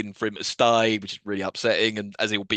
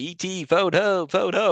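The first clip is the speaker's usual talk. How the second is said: light, higher, drawn-out alien voice; E T impression; 6 semitones higher, slower than usual. Fan imitating the alien, lightly put-on creature voice. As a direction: slowly imitate a little alien calling home. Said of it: "he starts going like".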